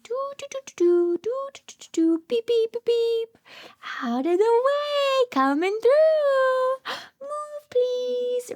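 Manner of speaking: high, sing-song toot and call; tractor-driving Spot. Pretend. Teacher voicing Spot on his tractor clearing the way, playful high driver voice. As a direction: play a toy driver beeping through. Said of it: "He's like".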